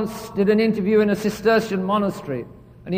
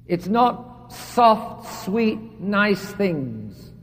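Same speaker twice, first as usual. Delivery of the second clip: slow emphasis; much slower than usual. Real, not acted. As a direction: stretch "not" very slowly, then fade.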